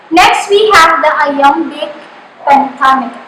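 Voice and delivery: normal talking voice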